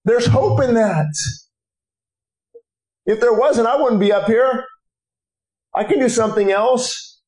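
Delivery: high, driving sermon delivery; impassioned preaching. Real, not acted. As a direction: preach it urgently, pitch lifted throughout. Preaching at full force.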